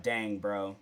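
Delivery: soft, low, deflated mock sigh; sad sigh. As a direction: let it drop, mildly let down. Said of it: "So then I got sad".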